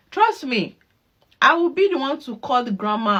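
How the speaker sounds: ordinary delivery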